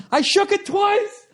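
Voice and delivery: very high, slow, giddy sing-song while laughing; giddy boast. Retold or imitated. The pothead friend gallops in circles, gloating giddily high. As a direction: crow it like a kid who won a prize. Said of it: "And he's going".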